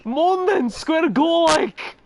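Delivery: high, loud Glasgow street hard man; squeaky Glasgow challenge to a fight; glasgow street accent. Pretend. Mock Glasgow street threats.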